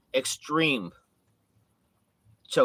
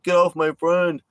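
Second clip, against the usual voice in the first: mock shout; 6 semitones higher, 7 dB louder than usual. Sneaker Chop shouting in fight.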